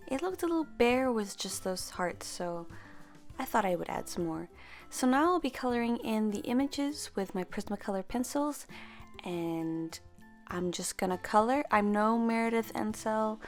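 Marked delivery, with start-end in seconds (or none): none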